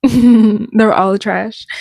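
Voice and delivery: loud, slow, laughing repeat; laughing hard. Real, not acted. Host mocks singer's songs to viewers, laughing hard. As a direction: crack up while saying it.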